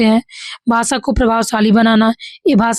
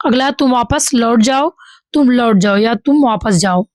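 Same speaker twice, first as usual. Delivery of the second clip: normal talking voice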